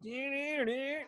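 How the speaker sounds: high, badly sung guitar-solo noise; imitated guitar riff. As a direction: mimic an electric guitar riff with your voice. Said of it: "the worst fucking Cult of Personality guitar solo ever".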